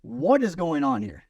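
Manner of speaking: hammed-up bewilderment; mock bewildered. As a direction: play the baffled kid at an intervention. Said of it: "Like, what".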